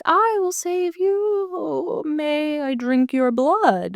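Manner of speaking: high, drawn-out, eerie singsong voice; fake Blackwell. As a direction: stretch every word in a high, put-on voice. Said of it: "that's a fake Blackwell".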